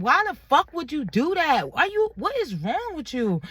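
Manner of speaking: high-pitched incredulous demand; retold outrage. Woman learning he blocked his sister's texts, retold outrage. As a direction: demand an explanation, voice jumping up.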